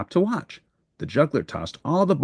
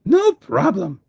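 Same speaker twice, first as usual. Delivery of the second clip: high, eager furry monster voice; a cheerful little monster who wants to help; Grover voice; 11 semitones higher, 5 dB louder, slower than usual. Acted. Grover replies.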